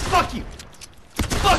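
angry curse. Real, not acted. Missed shots, cursing.

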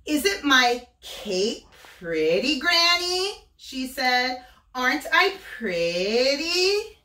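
put-on bright high voice of a vain little girl; sing-song Little Red bragging; high girlish Little Red. Wolf voicing Little Red telling of her trip to Granny.

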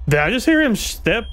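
high, tense whispered worry; straining to hear a step; scared horror game streamer. Real, not acted. Hearing footsteps in horror game.

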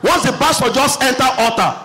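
usual speaking voice

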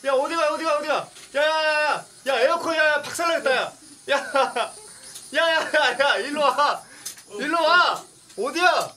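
high panicked shouting; yelling across the room. Real, not acted. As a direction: yell after a runaway drone. Chasing a runaway drone indoors.

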